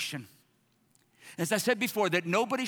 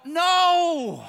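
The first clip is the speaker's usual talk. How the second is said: loud flat no; 8 semitones higher, 9 dB louder than usual. Acted. Retort to imagined critics.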